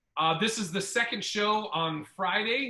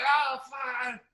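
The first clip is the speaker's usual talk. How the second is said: put-on flustered drunk; 6 semitones higher than usual. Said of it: "he's like".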